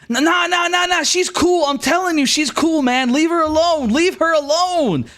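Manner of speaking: very high, pleading protest; worked-up, repetitive insisting; insistent bandit. Acted. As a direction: wave the gang off in a high voice. Dodger defending her to bandits.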